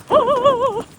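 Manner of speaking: loud, high yelps while fleeing; panicked running. Real, not acted. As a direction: shriek and run from the wave. Running from waves.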